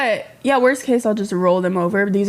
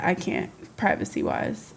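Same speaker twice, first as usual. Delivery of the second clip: whispered reply to chat; whispering; 4 semitones lower, 8 dB quieter than usual. Real, not acted. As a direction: say it under the breath. Reading chat, muttering reply.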